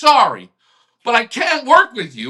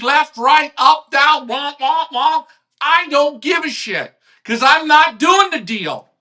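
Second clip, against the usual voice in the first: ordinary delivery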